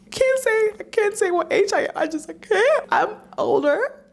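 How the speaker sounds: very high, flustered squeak; high squeaky voice. Pretend. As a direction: squeak it out in mock panic.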